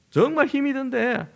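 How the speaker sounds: high, bright put-on politeness to mother-in-law; forced cheerful reassurance, pitched high; daughter-in-law's fake cheer. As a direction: chirp it sweetly like a dutiful daughter-in-law. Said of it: "며느리들은 이렇게 좀 표현을 하는데".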